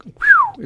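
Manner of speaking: squeaky whistle. Retold or imitated. Mimicking a squeaky high voice.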